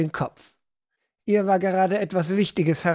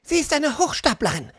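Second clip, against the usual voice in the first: put-on high, spat-out accusation from a girl; teenage sister hisses with rage; hissing in anger; 7 semitones higher than usual. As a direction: spit the words at the fortune teller. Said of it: "fauchte Hannah".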